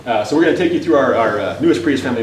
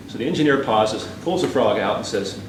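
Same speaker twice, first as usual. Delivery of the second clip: ordinary delivery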